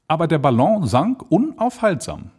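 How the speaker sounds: plain reading voice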